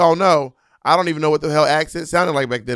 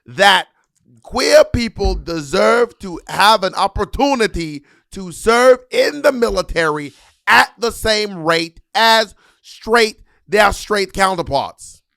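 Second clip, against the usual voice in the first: Harriet Tubman bit; 5 semitones higher, 6 dB louder, slower than usual. Acted. Comic playing Harriet Tubman in an old Brooklyn man voice. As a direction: drag out every word like an old Brooklyn uncle.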